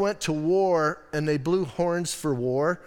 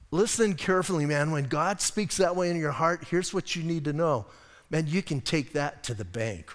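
ordinary talk, nothing marked

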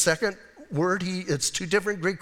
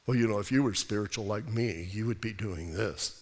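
smug know-it-all; 10 semitones lower, 7 dB quieter than usual. Retold or imitated. Imitating a self-righteous churchgoer.

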